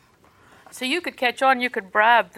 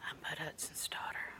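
hushed whisper over the desk; re-enacted whispered introduction; 4 semitones lower, 18 dB quieter than usual. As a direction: whisper it to the receptionist. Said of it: "it struck me that I was whispering".

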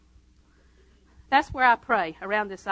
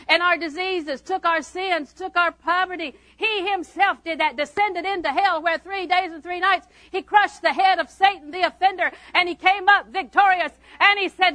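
loud, raised preacher's voice; full-force preaching; 7 semitones higher, 6 dB louder than usual. Acted. Preacher telling the congregation how they were set free, full-force preaching.

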